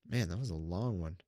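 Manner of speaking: ordinary delivery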